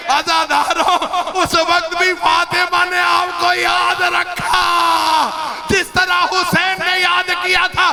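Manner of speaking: mourning preacher in full lament; majlis lament. Acted. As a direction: cry out the grief, stretching each phrase.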